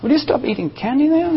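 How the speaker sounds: normal talking voice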